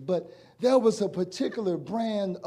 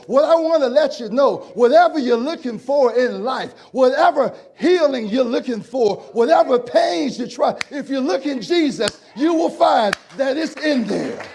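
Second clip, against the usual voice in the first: louder, higher, building preacher's call; full-force preaching; 5 semitones higher, 7 dB louder than usual. Acted. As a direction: preach it out to the congregation. Preacher applies the story.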